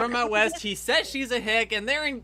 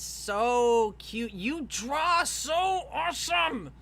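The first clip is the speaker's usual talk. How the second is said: gushing praise; 3 semitones higher, much slower than usual. Real, not acted. Host praising Bella's drawings, gushing. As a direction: gush slowly, stretching every compliment. Gushing over a friend's fan art.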